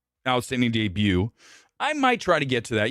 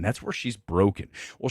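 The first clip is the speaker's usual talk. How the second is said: normal talking voice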